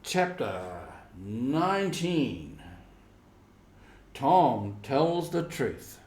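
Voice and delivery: slow, deliberate heading; chapter title read. A narrator's reading. Narrator opening a new chapter, slow and deliberate. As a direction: announce the chapter slowly.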